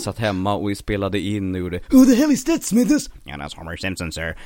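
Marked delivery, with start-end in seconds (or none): 1.9-3.1 high, loud cartoon boss bellow; much higher, louder cranky boss; Simpsons impression. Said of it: "började jag imitera Simpsons röster". 3.2-4.4 lower, soft servile reply; low, quiet, fawning aide; Simpsons assistant impression. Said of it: "började jag imitera Simpsons röster".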